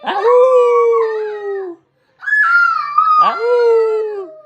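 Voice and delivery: playful high, loud wolf howl; long drawn-out "aroo" howl. Pretend. Dad showing his child a wolf sound, high playful howl. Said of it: "Can you make a wolf sound?".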